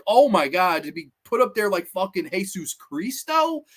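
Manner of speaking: usual speaking voice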